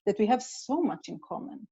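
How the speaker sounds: ordinary talk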